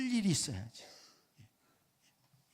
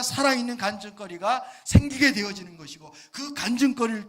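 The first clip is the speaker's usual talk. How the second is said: higher, louder preaching at full force; fiery preaching; 4 semitones higher, 10 dB louder than usual. Acted. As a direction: preach it out, building to a shout. Preacher builds again.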